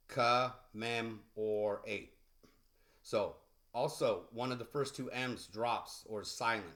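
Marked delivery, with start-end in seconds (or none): 0.1-2.1 Commemorate drawn out slowly, kuh-MEM-or-ate; slow demo pronunciation. Shown as a demonstration. Teacher sounding out Commemorate for learners, very slow. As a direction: stretch Commemorate out syllable by syllable. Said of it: "I spelled it K A dash M E M dash O R dash A T E".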